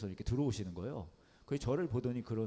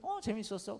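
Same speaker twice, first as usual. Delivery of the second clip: a hint of a higher little girl voice; child answer; 9 semitones higher than usual. Daughter answering her dad, lightly voiced higher. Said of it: "그 아이가 그런 거예요".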